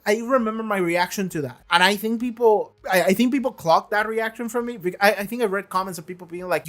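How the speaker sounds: ordinary talk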